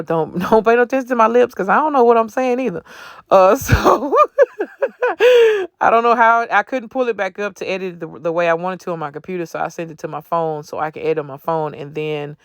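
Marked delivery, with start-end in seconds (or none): 0.0-8.0 laughing. Real, not acted. Laughing at her own voiceover.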